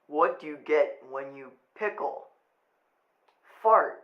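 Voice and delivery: quiet, low joke-teller voice; Tony impression. Posing as dead Tony telling a joke, low impression. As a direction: speak low and soft, posing as someone else. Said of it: "that was a really good Tony impression".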